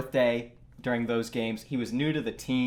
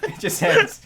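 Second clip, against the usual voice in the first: laughing; 4 semitones higher, 6 dB louder than usual. Real, not acted. Repeating friend's line, laughing.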